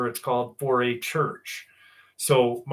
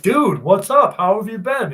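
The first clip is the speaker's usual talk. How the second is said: higher, louder hey-dude welcome; excited greeting; 8 semitones higher, 8 dB louder than usual. Retold or imitated. Running into Gabe at Lowe's after years, excited greeting. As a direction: call out happily to an old friend.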